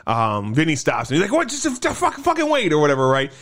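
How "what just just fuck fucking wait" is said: high-pitched stammered protest; imitating someone halting things in alarm; retold frantic plea. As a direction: blurt a mock-urgent stop, tripping over words. Retold Vinny urgently stops killing.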